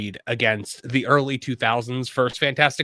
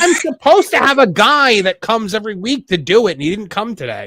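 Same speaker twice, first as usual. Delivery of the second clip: louder, higher indignant complaint; exasperated gripe; 5 semitones higher, 5 dB louder than usual. Real, not acted. Pool owner griping about the missing cleaner, exasperated. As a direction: raise your voice in exasperation.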